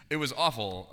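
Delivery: a real laugh right before the words. Real, not acted. Recalling a painful spiral, laughing nervously. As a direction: laugh it off while admitting it was awful. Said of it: "I don't know why I'm laughing".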